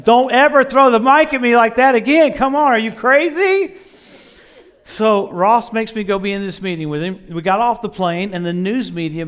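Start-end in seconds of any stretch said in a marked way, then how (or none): 0.0-3.7 loud, high-pitched telling-off; exasperated shout, climbing higher to the end; indignant yell. Retold or imitated. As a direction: yell it in outraged disbelief. Retold outburst at friend throwing mic.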